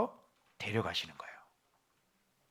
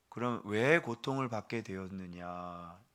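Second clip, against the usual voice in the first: lower, slow, gentle question; calm divine answer; 5 semitones lower, 9 dB quieter, slower than usual. Acted. The Lord answering the sufferer, softly and slowly. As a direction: ask it back softly, like the Lord. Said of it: "주님은 이렇게 대답하는 거예요".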